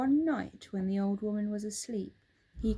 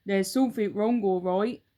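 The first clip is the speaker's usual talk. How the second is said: put-on louder, sly gloating old man; enjoy the mean joke, voiced louder; Mister Twit teasing his wife, gloating; 8 dB louder than usual. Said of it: "beginning to enjoy himself".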